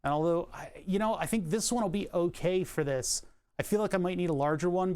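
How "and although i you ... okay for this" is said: ordinary delivery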